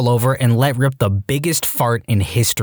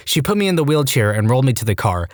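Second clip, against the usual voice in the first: usual speaking voice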